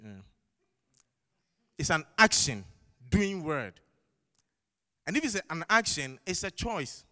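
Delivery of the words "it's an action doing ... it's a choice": forceful preaching. Acted. Preaching climax.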